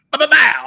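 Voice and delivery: loud, high "ba-ba-bow"; imitated bang noise. Recalling what went on all day, loud imitated bang.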